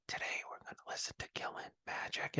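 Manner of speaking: fast unvoiced whisper; ASMR whisper. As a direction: breathe it like an ASMR video. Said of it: "A S M R".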